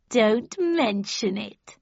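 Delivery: very slow, drawn-out cat voice; Cheshire Cat. Acted. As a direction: languid grinning cat. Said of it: "said the cat".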